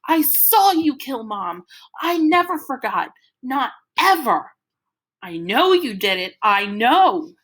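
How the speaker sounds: high, loud desperate accusation; accusing shout. A narrator's reading. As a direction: keep yelling it at him. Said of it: "and I'm going".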